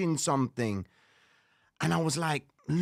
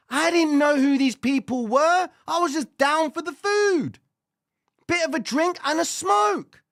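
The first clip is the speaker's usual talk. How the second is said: higher and louder, near a shout; comic outburst; 11 semitones higher, 6 dB louder than usual. Real, not acted. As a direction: rant it to the crowd. Emphatic comic rant.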